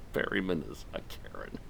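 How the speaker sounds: words squeezed out while cracking up; quietly tickled by your own joke; laughing. Real, not acted. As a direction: talk through soft giggles.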